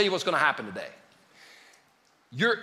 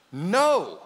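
usual speaking voice

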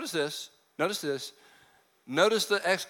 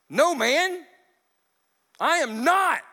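high, louder heated denial; retold yell; 9 semitones higher, 4 dB louder, slower than usual. Peter retorting to the accuser, loud. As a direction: yell it back defensively. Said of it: "Is he yelling still?".

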